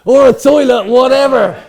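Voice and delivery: very loud, high-pitched holler; joking shout. Real, not acted. Guest adds a toilet joke amid laughter, shouting. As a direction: top the gag at full volume.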